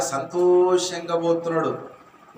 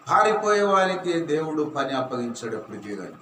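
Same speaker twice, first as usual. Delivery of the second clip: ordinary delivery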